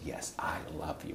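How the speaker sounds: very soft, low, breath-only reassurance; tender whisper. Retold or imitated. Wife hugging her husband and reassuring him, tender whisper. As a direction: whisper it lovingly into a hug.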